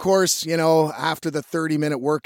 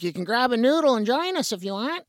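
put-on old lady voice; 7 semitones higher, faster than usual. Old lady at aquafit invites him.

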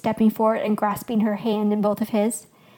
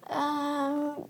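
soft, higher-pitched drawn-out um; hesitant stalling; 5 semitones higher, 8 dB quieter than usual. A narrator's reading. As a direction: stall softly, unsure what to say. Husband at a loss to explain.

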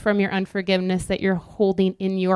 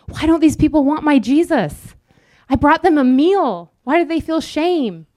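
higher, louder, indignant whine; a hurt do-gooder complaining, higher; whiny self-righteous believer; 6 semitones higher, 3 dB louder than usual. Retold or imitated. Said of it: "we're like".

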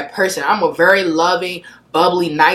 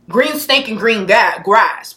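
ordinary talk, nothing marked

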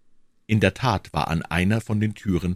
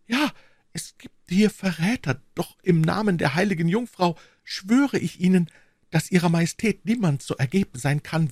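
put-on higher-pitched earnest young woman; devoted young servant woman; 11 semitones higher than usual. Young servant pledges herself to her queen, earnest and devoted.

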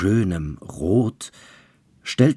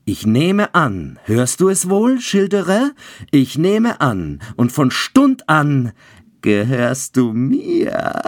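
triumphant devil; 8 semitones higher, 6 dB louder than usual. A narrator's reading. Devil claims Childeret's soul, loud gloating triumph. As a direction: crow over the trapped soul.

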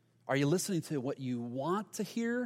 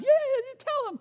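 squeaky, much higher mock cheering; hype-man cheer; 4 dB louder than usual. Buddies bouncing behind Rehoboam, squeaky mock cheering. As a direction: play a giddy sidekick bouncing behind the king. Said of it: "just bouncing around behind him".

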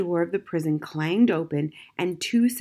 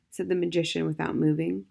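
ordinary delivery

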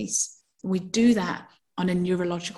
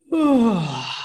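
yawning groan; 3 dB louder than usual. Shown as a demonstration. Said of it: "then you go".

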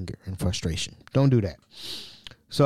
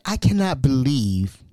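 usual speaking voice

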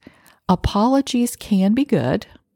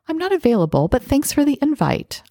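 high, friendly turning-down; polite decline; 8 semitones higher than usual. Acted. Guest turning down an invite without apologizing, polite decline. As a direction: lift the pitch to decline graciously.

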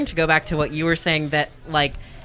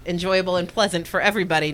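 usual speaking voice

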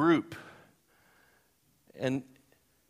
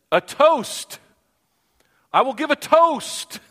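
high, loud announcement of a toast; mock loud toast; 11 semitones higher, 8 dB louder, slower than usual. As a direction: proclaim it way up high and loud, slowing on the second line. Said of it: "and all of a sudden he said".

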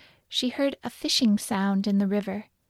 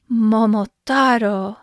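put-on drawn-out, louder call as the old man; slow, proud storybook call at natural pitch; old man's cry; 6 dB louder, slower than usual. A narrator's reading. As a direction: cry the name out slowly and loudly. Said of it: "cried the old man".

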